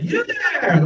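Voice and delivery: theatrical royal summons; booming actor call. Retold or imitated. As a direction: bellow it like King Lear pointing. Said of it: "like that, and points".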